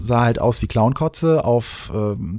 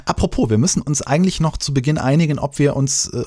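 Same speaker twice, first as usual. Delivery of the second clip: usual speaking voice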